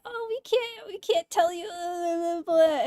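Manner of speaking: high, put-on snooty customer service voice; mock payment company rep. Streamer mocking PayPal's brush-off in a prissy high voice. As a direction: mimic the company brushing you off. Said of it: "And they're like".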